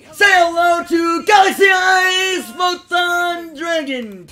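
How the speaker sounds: high, slow, booming anime villain shout; Kite impression. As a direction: announce the dragon like a dramatic anime rival. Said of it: "Did I do my kite impression well?".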